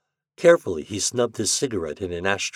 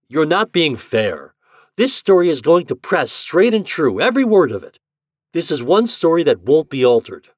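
hurt insistence; 9 semitones higher, 10 dB louder than usual. A narrator's reading. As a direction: defend yourself hard. Shandor insists angrily.

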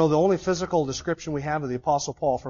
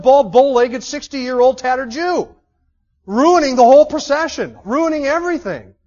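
higher and louder, scornful complaint; eye-rolling disdain; 7 semitones higher, 9 dB louder than usual. Retold or imitated. Roman official fed up that Paul spoils the ceremony, loud scornful disdain. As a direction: sneer it out as an offended Roman official. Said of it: "you'd be rolling your eyes saying".

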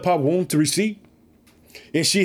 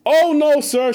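high, louder pleading cry; pleading woman; 9 semitones higher, 5 dB louder, slower than usual. Acted. Preacher acting Hannah answering the priest, high pleading.

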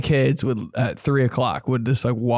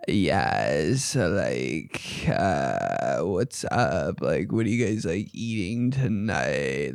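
much lower, croaky sick voice; 8 semitones lower, 4 dB quieter, much slower than usual. Shown as a demonstration. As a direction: talk like you have a sore throat. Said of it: "That's how I sound when I'm sick".

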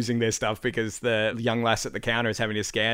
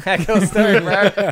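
laughing, high, louder; 8 semitones higher, 6 dB louder, slower than usual. Retold or imitated. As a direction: echo it high and loud while laughing. Echoes catchphrase laughing.

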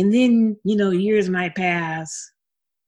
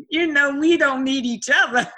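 higher-pitched mock dismissal; playful acted quote; 8 semitones higher, 3 dB louder than usual. Singer acts out the awkward reunion, playfully dismissive. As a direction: act it out higher, laughing around it. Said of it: "you be like".

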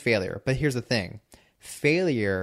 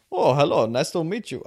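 badly faked Indian accent, higher-pitched; mock Indian accent; 5 semitones higher than usual. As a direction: do an unconvincing Indian accent greeting. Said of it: "this Indian guy comes up to me".

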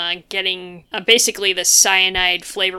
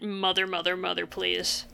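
hushed, much softer quoted plea; 9 dB quieter than usual. Retold or imitated. Retelling the survivor's memory, a hushed quoted plea. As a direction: murmur it like a haunting memory. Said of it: "him saying that".

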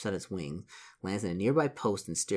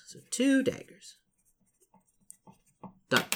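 playful echo. Pretend. Repeats line in put-on voice.

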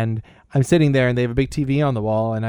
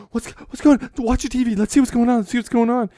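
much higher excited voices, mock alarm; alarmed people; 9 semitones higher than usual. People run in after the earthquake, mock alarm. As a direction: rush in to see the news. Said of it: "they're like".

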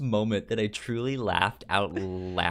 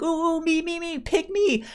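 put-on high, louder, eager kid voice; 6 dB louder than usual. Kids begging the teacher to pick them, high eager mock voices. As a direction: beg to be picked like a schoolkid.

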